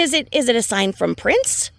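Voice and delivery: normal talking voice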